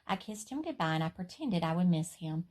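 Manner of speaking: plain reading voice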